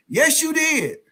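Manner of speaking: high-pitched mock insistence; imitated insistent victim. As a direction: squeak back a stubborn contradiction. Acts victim insisting in retold argument.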